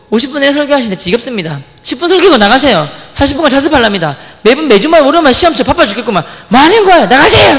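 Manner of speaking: rising from heated protest to a very high, loud yell; a furious student ordering her out; escalating shout. Retold or imitated. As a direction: build up until you scream her out. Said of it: "과함 지른 거예요".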